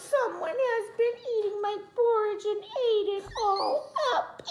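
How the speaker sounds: very high, tiny wailing voice; crying Baby Bear. A narrator's reading. Baby Bear cries that his porridge is all gone. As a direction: wail like a small cub upset. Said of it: "Baby Bear cried".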